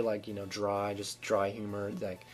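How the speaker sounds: soft, low, dull voice; boring monotone. Shown as a demonstration. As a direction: drone it out lifelessly.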